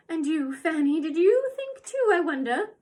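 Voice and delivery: a tiny woman's shrill taunt; squeaky old woman needling her daughter; little high voice. Acted. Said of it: "The little high voice".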